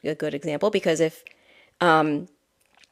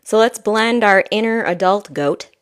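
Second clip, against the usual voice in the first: ordinary talk, nothing marked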